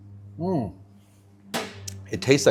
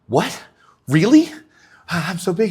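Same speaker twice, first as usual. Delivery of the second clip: delighted surprise; 8 semitones higher, 5 dB louder than usual. Retold or imitated. Guy's delighted reaction in joke.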